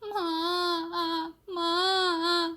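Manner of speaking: high, drawn-out crying "माँ". A narrator's reading. Frightened baby bird crying for its mother. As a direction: wail for mother through tears. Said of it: "अब वो रोने लगा".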